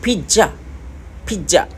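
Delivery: पिज़्ज़ा repeated as phija with an aspirated p and a j; Korean-style phija, said three times; Korean accent. Shown as a demonstration. Teacher drilling Korean पिज़्ज़ा, Korean accent. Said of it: "double ज़ेड को वो जे करके बोलते हैं".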